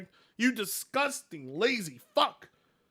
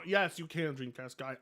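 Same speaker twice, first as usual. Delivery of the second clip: normal talking voice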